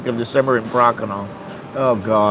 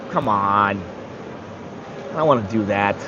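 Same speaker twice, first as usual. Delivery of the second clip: low, soft, mock-weary protest; reluctant self-talk; 7 semitones lower, 8 dB quieter than usual. Man answering his own gym plan, reluctant. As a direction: grumble it low, as if talking yourself out of it. Arguing with himself about gym.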